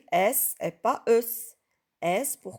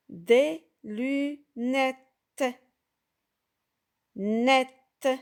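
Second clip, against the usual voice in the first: "lunettes" stretched very slowly, then "Net" alone, louder; slow model word; 4 semitones higher, 4 dB louder, much slower than usual. Shown as a demonstration. Teacher to pupils, modelling "lunettes" very slowly. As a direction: draw each syllable out for the class. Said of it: "Comme dans le mot ici".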